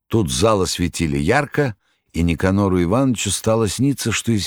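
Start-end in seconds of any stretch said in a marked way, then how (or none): none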